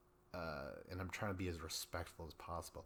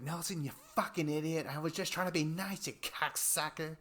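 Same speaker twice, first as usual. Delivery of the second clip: higher and louder mock tirade, hammed-up insults; mock angry Kayla; 8 semitones higher, 4 dB louder than usual. Recapping the show, imitating Kayla cursing at Nelson, in a hammed-up angry voice. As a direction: rip into him in an exaggerated, imitating fury.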